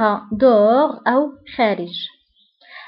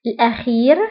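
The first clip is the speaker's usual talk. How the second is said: ordinary talk, nothing marked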